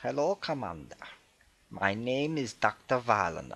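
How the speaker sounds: put-on measured German-accented greeting. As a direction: introduce yourself in a light German accent. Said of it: "talks with a bit of a German accent".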